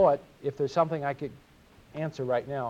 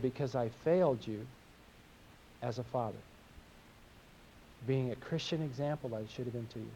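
softer, hushed retelling of a father's apology; tender confession; 4 semitones lower, 7 dB quieter than usual. Retold or imitated. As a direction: say it gently, as if asking a daughter's forgiveness. Father confessing to teenage daughter.

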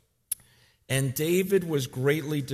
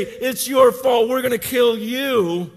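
loud, high mob shouting; furious shout; 11 semitones higher, 10 dB louder than usual. Retold or imitated. Soldiers shouting threats at David, furious. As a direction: hurl it at him.

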